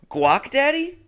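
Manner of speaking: high puzzled quote; baffled text read. Retold or imitated. Repeating the text.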